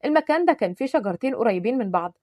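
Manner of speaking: plain reading voice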